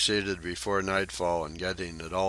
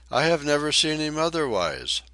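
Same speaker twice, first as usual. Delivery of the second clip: much higher, louder lady's voice, curious; young lady; 9 semitones higher, 6 dB louder than usual. A narrator's reading. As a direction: ask politely in a high ladylike voice. Maud voiced by narrator.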